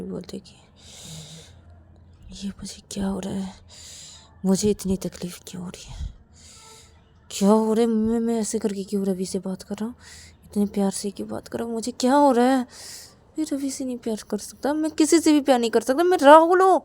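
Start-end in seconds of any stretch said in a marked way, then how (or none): 2.3-6.1 hushed, breathy inner monologue; troubled self-questioning. Acted. Rahul strokes Ravi's head, whispering to himself. 7.3-16.8 fast, building to a high, loud outburst; agitated self-reproach. Acted. Rahul denies he can love, increasingly agitated.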